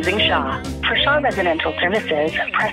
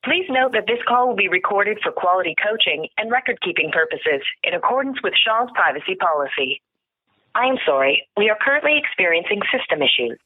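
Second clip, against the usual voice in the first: phone menu voice. Real, not acted. Shaw phone menu played.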